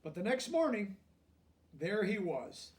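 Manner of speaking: lively picture-book narration; reading to children. A narrator's reading. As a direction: read it like a bedtime story to kids.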